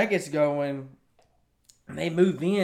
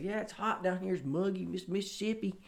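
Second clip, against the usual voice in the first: imitating a real country Southern accent; 4 semitones higher, 8 dB quieter than usual. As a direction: lay on the Mississippi country twang, a bit higher. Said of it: "I'm sounding real country".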